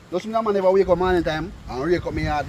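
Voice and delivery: ordinary talk, nothing marked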